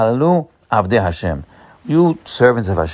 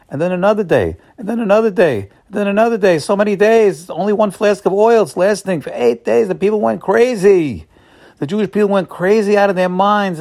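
growing excitement; 9 semitones higher than usual. A narrator's reading. Miracle of oil lasting days.

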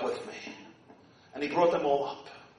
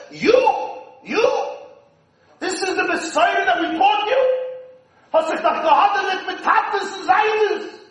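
a touch of scornful disbelief; mocking relatives; 10 semitones higher, 5 dB louder than usual. Tzaddikim scoffing at Moshe, mild mock disbelief. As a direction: play the doubters, mildly mocking.

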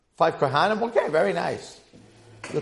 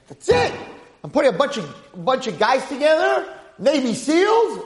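high, loud call to action; rally the troops at full force; fired-up leader's shout; rallying cry; 8 semitones higher, 3 dB louder than usual. Acted. Acting out an imagined rallying leader.

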